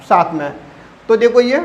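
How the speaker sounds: normal talking voice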